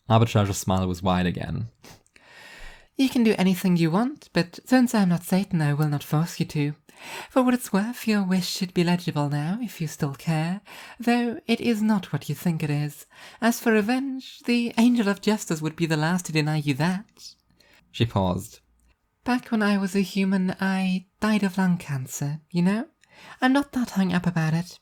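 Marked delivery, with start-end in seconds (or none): 3.0-17.3 high, bright cheerful angel voice; an upbeat angel showing off her plan; high, cheerful divine woman; cheerful angel Arbitrage. A narrator's reading. 19.2-24.8 high, bright cheerful angel voice; an upbeat angel showing off her plan; high, cheerful divine woman; cheerful angel Arbitrage. A narrator's reading.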